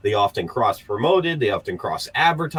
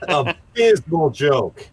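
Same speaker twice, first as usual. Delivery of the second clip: slow deadpan; 5 semitones higher, 4 dB louder, much slower than usual. Real, not acted. Judging the joke slowly.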